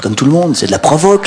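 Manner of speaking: plus aigu, outré; s'offusque comme un flic excédé; policier indigné. Retold or imitated. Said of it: "Un autre policier". (policier indigné)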